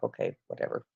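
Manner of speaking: breathy, dismissive "okay, whatever" under the breath; a shrugged-off sponsor who refused, whispered. Retold or imitated.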